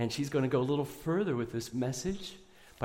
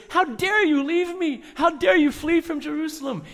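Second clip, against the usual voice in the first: much higher, much louder mock scolding; mock angry rebuke; 11 dB louder than usual. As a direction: blast it like an outraged master he never was. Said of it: "didn't come after them and say".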